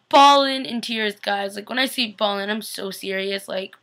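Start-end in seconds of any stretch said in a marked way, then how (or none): none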